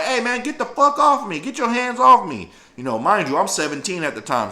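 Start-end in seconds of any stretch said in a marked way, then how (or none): 0.0-2.5 defiant high yell; pinned teen shouting at his captors, defiant; bucking up. Retold or imitated. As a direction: push back hard at them. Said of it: "so I'm really bucking up".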